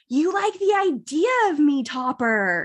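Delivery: high, put-on dramatic quote; show character impression. Quoting an Outer Banks girl confronting Topper, dramatic high voice. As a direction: pitch it up and act out the confrontation. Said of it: "where she's like".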